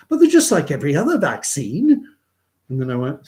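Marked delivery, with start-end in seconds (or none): 0.1-2.1 higher, louder mock insistence; imitating Anu. Tennis partner insisting it's a normal vaccine, higher mock voice. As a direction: press it like it's obvious. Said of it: "And she goes".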